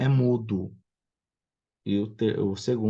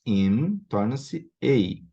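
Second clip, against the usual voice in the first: slow teaching emphasis; much slower than usual. Shown as a demonstration. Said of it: "aquela regra que nós já aprendemos".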